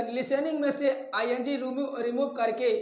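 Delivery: ordinary talk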